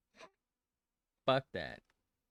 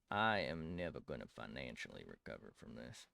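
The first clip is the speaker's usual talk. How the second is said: deadpan meme quote; 9 semitones lower, 6 dB quieter than usual. Pretend. Quoting a famous meme line.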